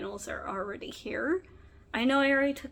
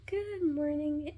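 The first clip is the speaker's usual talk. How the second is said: ordinary talk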